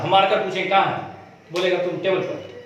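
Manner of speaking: ordinary delivery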